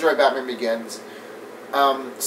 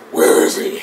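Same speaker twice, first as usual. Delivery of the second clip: raspy whispered growl; throaty, voiceless rasp; mock the hoarse superhero voice; Batman; 4 dB louder than usual. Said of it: "somehow this voice".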